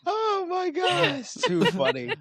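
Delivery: very high, laughing squeal; real laughter squeezing the words high; helpless laughter. Real, not acted. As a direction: gasp it out while cracking up. Cracking up at the joke.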